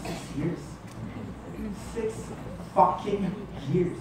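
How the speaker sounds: slow, low, barely voiced hurt; hushed disbelief. Jilted partner counting lost years, hushed and slow. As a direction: let each word sink, soft and slow.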